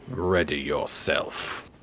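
deep, slow solemn challenge; mock epic voice.

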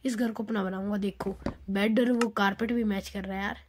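ordinary delivery

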